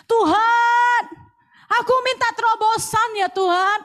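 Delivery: loud high plea to God; loud high pleading prayer in the kitchen. Retold or imitated. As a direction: call out begging for breakthrough. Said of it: "Satu kali saya pernah nyemplung".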